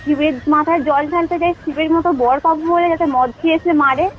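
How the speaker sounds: ordinary talk, nothing marked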